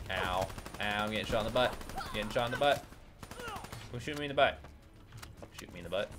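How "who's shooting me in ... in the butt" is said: usual speaking voice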